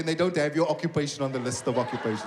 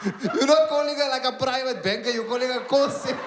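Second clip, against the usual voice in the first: mocking disbelief; 5 semitones higher than usual. Call centre agent mocking a bond caller, incredulous. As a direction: lecture him high and mocking. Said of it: "at the call center making fun of me".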